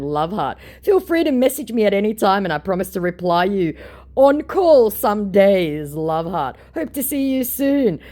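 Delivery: high, loud gushing fan message; mock fake Keanu. Reading the fake Keanu's gushing message, high mock voice. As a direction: gush like a phony star. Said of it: "I'm gonna read out Keanu Reeves".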